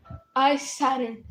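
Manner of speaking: ordinary delivery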